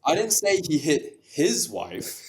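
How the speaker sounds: slow line, lean hard on "his"; stress on HIS. Shown as a demonstration. Showing the next version, heavy stress on "his". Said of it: "now stress different words in the sentence".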